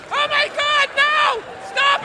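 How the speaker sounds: very high mock fan shriek; mock screaming fan. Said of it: "Women are screaming".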